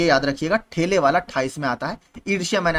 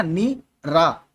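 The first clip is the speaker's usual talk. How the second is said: slow spelling out; much slower than usual. Shown as a demonstration. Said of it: "जैसे निरपेक्ष में लिखते हैं ना".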